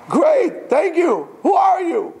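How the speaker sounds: very high shouted thanks; overjoyed relief. Retold or imitated. Climber hears a voice, shouts high with joy. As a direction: burst out with relief.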